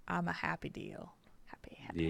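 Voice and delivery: soft, low happy murmur; whispering contentedly. Real, not acted. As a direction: whisper it to yourself.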